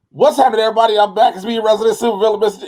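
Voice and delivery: high, loud intro from away from the mic; hollow, off-mic sound. Shown as a demonstration. Host showing co-host how the recording sounded, hollow and loud. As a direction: project it hollowly, like a distant mic. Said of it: "You see how hollow that sounds?".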